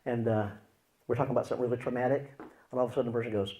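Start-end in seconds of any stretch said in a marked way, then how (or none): none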